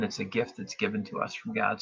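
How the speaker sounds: usual speaking voice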